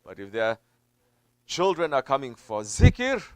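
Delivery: ordinary delivery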